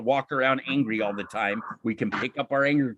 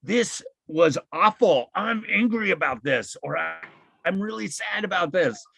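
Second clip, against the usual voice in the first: higher, open-hearted venting, lightly acted; venting feelings; 5 semitones higher than usual. Shown as a demonstration. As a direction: let the feelings out honestly, a touch acted. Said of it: "As opposed to venting, where you go".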